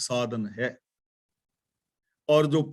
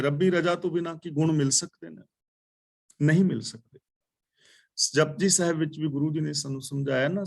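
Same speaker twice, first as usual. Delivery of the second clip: ordinary talk, nothing marked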